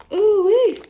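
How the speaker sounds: high, loud delighted whoop; ooh-wee called out high and loud; excited exclamation. Real, not acted. Reacting to favorite pasta.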